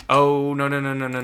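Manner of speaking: sudden loud dismayed no, no; alarmed no. Real, not acted. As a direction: cry out in alarm as the ship breaks off. Ship ruptures bulkhead in battle.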